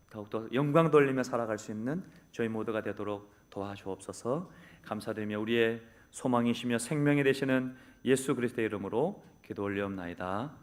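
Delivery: hushed, earnest pastoral prayer voice; closing prayer. Acted. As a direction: pray humbly before the congregation.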